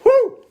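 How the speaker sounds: high, loud woo; whoop. Real, not acted. Cheering his good coffee, high loud whoop. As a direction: let out a piercing whoop.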